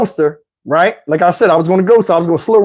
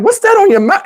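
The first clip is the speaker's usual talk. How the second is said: high incredulous question; 13 semitones higher than usual. Retold or imitated. Asking date about her mouth.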